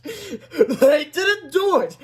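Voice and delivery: high, slow words through laughter; laughing. Acted. Teacher laughing at the principal. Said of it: "laughed Miss Ripple".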